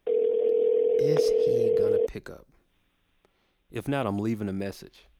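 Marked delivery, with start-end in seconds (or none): 0.1-2.5 slow drawn out. Real, not acted. Repeats while phone rings.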